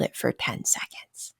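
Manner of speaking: soft breathy whisper as if out of air; whispering as though holding a breath. Acted. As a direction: whisper it like the breath has run out. Whispers while describing breath-holding.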